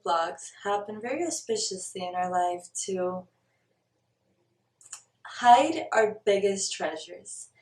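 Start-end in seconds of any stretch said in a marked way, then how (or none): none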